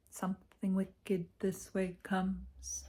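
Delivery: slow, eerie breathy whisper; spooky whisper. Pretend. To viewers, teasing a dark omen in a slow whisper. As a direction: hiss it like an ominous prophecy.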